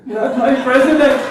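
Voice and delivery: loud, high American protest wail; mock American crying. Comedian imitating his son crying in American, loud wail. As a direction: blow it up into a hammed-up American bawl. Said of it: "cries with an American accent".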